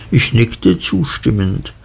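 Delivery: plain reading voice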